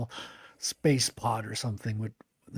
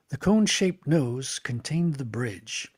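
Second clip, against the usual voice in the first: normal talking voice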